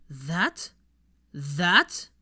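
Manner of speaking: incredulous repeated question; disbelieving. Acted.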